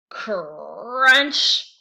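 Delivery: loud crunching sound word; crunch effect. A narrator's reading. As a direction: bite down on the word. Sound of biting carrot.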